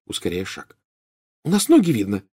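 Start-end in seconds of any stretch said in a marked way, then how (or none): 1.4-2.2 high, worried exclamation; alarmed. A narrator's reading. Hermione warns friends under the cloak, alarmed. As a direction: warn them with a touch of alarm. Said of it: "встревожилась Гермиона".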